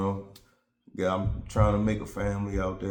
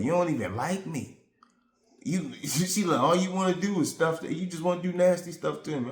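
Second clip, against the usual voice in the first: mock accusing girlfriend voice, pitched higher; lightly voiced upset partner, higher; imitating girlfriend; 7 semitones higher than usual.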